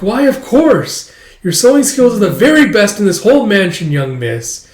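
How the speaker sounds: high, loud effusive servant; doting maid, praising. Acted. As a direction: heap praise in a lilting maid voice.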